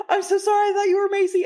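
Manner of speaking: high, frantic apology; mortified apology. Retold or imitated. Apologizing in horror to sweet little Liz, high and frantic. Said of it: "and I said".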